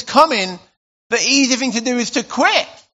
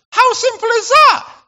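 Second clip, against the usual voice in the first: much higher and louder, an excited cry; excited exclamation; 13 semitones higher, 6 dB louder than usual. Real, not acted. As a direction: throw it out to the room with delight. Preacher exclaims simplicity.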